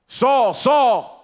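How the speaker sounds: high, loud, drawn-out call of the name; loud call from heaven. Acted. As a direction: call Saul's name like a sudden startling shout. Jesus calls out to Saul.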